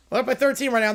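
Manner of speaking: ordinary talk, nothing marked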